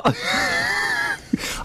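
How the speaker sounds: squealing giggle, soft; high laughter. Real, not acted. Host laughs at Kath's rambling.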